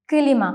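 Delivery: "കിലിമ" with a short vowel between k and l; correct Turkish pronunciation. Shown as a demonstration. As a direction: split "കിലിമ" into kı-li-ma. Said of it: "ഇ എന്ന letter ഉള്ളതുപോലെ".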